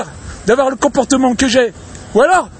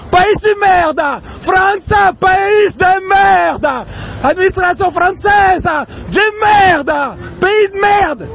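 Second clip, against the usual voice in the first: real furious shout; 4 semitones higher, much slower than usual. Real, not acted. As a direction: bellow it out with all the rage left. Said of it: "J'ai même pas la voix pour crier".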